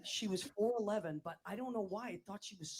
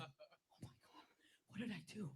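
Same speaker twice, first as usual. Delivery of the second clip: scared whisper; 11 dB quieter than usual. Retold or imitated. Girl called to the office, whispering in fright. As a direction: breathe it out like a terrified first grader. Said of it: "I was like".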